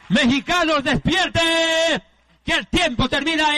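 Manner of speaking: fervent revival preaching voice; preaching at full force. Acted. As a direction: preach it like a revival altar call. Preacher exhorting congregation.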